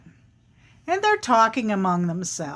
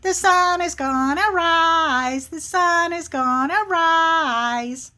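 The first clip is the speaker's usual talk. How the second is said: high, drawn-out singsong taunt; teasing little squirrel chant; smart-alecky squirrel; 6 semitones higher, slower than usual. Acted. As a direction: needle the bear in a higher singsong chant. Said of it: "that little smart alecky squirrel is saying".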